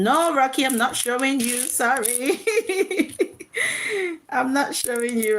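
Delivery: ordinary talk, nothing marked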